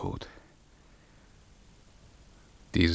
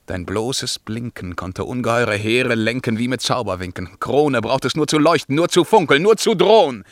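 king proclaiming the crown's lost power, growing louder; rising declamation; 3 semitones higher, 9 dB louder than usual. Acted. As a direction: swell into a proud cry.

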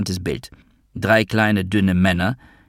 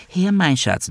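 much higher, put-on motherly woman's voice; Magda, high female voice; 11 semitones higher, slower than usual. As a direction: coo to a child in a high female register. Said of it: "mit hoher weiblicher Stimme". (hohe, gespielte Frauenstimme einer Mutter)

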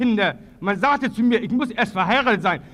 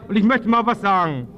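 ordinary delivery

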